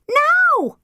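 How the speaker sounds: high, loud scream; one last desperate shriek. A narrator's reading. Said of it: "screamed Tilly".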